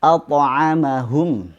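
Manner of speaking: at'amahum said slowly with a full heavy t bounce; at'amahum with the t bounced thick; heavy qalqalah. Shown as a demonstration. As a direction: correct take, t kept thick. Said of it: "misalnya pada kata".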